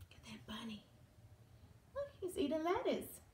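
hushed playful whisper; conspiratorial whisper to the child. Acted. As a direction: whisper it like a secret. Reading to toddler, aside.

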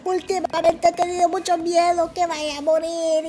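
a hammed-up sobbing imitation; mock crying. Vlogger mocking the sister's tearful words, mock crying. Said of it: "Pero llorando, familia, llorando".

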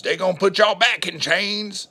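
a mocking impression thrown in mid-rant; mock Biden preacher voice. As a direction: ham up a borrowed preacher cadence.

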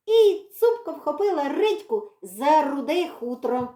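plain reading voice

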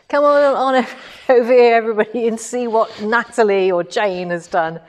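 mock-call the class over in an American accent; American accent, teasing re-run of Vicky's call; playful American accent. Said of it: "I did mimic the American accent".

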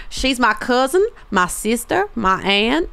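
put-on southern US twang; southern accent. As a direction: list them off in a playful southern accent. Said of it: "I can do a good southern accent".

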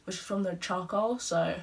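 talking with teeth strips on; speaking around a dental strip. Real, not acted. Seen 0: Dark strip visible covering teeth while talking; applied just before.

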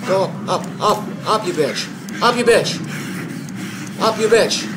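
loud, high, terrified screaming; panicking gamer. Real, not acted. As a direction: shriek it while being chased. Chased by monster in game.

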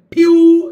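Pew loud, high and held; clear stressed syllable. Shown as a demonstration. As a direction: belt out Pew as the stressed beat. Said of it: "it is clear".

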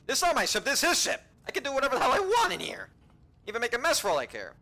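high, loud indignant rant; mock indignant. Real, not acted. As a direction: protest loudly, pitched well up. Mock indignant rant about cleaning ship.

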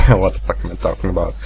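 ordinary delivery

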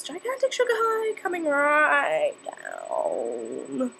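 slow droopy drawn-out voice; winding down. Pretend. Teen acting out her sugar crash. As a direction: let it sag like a crash.

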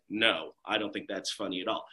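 put-on low, deadpan bored girl; flat grumpy girl. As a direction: grumble it like a girl who never laughs. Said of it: "she's like".

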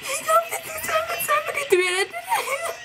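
overcome, wailing with happiness; crying with joy. Real, not acted. Skater wins, fan overwhelmed.

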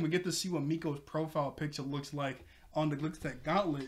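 ordinary talk

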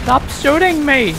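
loud, high yell of protest; yell at enemies. Real, not acted. Player yelling at enemies shooting him, loud and high. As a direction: shout at them to stop firing.

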